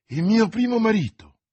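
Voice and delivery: high, loud, startled cry; frightened exclamation. A narrator's reading. Milady, stunned by mention of her first husband, frightened loud exclamation. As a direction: blurt it out in fright, voice jumping up. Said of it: "esclamò Milady ... con occhi spauriti".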